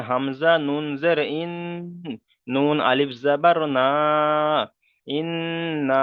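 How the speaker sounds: ইন্না with a long level hum on the n; ghunnah demo. Shown as a demonstration. Teacher demonstrating ghunnah on nun, ইন্না with its n held nasally. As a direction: draw out the double ন in ইন্না, then lengthen the final না. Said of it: "নুনের উপর তাসদিদ দিয়ে গণনা করলাম".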